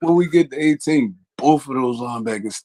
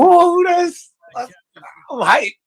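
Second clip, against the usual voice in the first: excited; much slower than usual. Real, not acted. Reacting to a draft pick.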